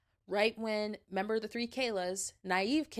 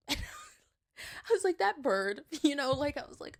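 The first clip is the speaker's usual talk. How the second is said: laughing; 8 semitones higher, slower than usual. Real, not acted. Laughing retelling bird moment.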